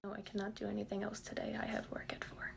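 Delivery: flat, defeated whisper. Acted. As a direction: repeat it hushed, beaten. Brainwashed whispered repeating.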